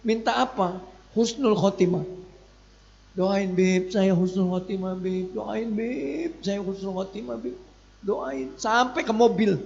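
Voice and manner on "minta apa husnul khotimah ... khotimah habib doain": softly retold tearful begging, much softer; a hushed, half-crying plea for a blessing; tearful pleading.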